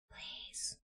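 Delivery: soft, voiceless please; real defeated whisper. Real, not acted. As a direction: beg the screen under your breath. Pleading with the computer.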